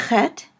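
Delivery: "chet" opening on a back-of-throat rasp; guttural ch. Shown as a demonstration. Teacher introducing a difficult letter to English speakers, guttural ch. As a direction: start "chet" with the guttural ch of Bach. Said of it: "pronounced in the back of the throat".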